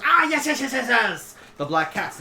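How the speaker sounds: high, rapid string of yeses, falling away; King Sideshow. Acted. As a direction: burst out in recognition, then settle. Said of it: "And Sideshow goes".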